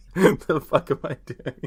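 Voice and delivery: usual speaking voice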